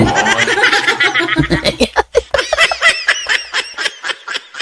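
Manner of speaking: drawn-out giggle; high giggling fit. Real, not acted. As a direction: keep giggling at the joke. Laughing at own story.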